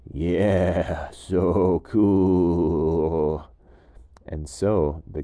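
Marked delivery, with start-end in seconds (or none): 0.1-3.5 lightly voiced goat, drawn-out approval; goat agreeing. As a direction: goat won over, a bit in awe. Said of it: "the goat thought ... and said".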